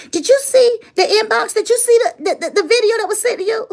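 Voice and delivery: high, rushed and laughing, retelling Tila's call; excited friend. Retold or imitated. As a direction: she can barely get it out for laughing. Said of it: "And Tila called me".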